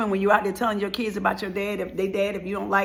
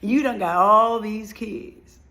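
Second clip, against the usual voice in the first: normal talking voice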